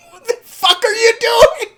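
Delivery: high, crying shout; anguished yell. Real, not acted. Reviewer yelling at the character on screen, high and crying. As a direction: yell at her in despair.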